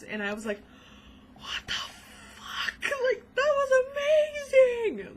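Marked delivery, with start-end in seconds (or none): 1.4-2.8 slow, voiceless gasp of disbelief; stunned whisper. Retold or imitated. Viewer retelling shock at the film, stunned whisper. 2.8-5.2 very high, loud, drawn-out squeal; overwhelmed excitement. Real, not acted. Fan raving about a film scene, squealing excitement.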